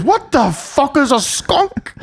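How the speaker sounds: high, baffled mock mum voice; bewildered mum. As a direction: squeak it out like a puzzled mum. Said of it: "She goes".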